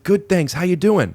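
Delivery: high, keen reply and question; over-connected greeting. Retold or imitated. Agent returning a greeting at the agency, over-connected. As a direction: imitate an overly connected agent. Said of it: "It was the tone".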